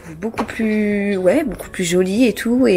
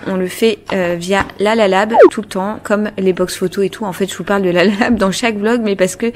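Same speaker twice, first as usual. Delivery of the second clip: normal talking voice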